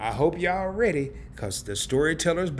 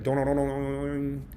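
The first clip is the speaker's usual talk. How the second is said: imitated alarm chime; 5 dB quieter than usual. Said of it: "we're like".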